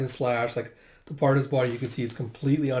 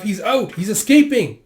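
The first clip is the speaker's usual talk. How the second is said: high, loud alert; alarm shout; 8 semitones higher, 9 dB louder than usual. Acted. Villagers see Jeha break out, alarmed shout. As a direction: raise the alarm, building louder.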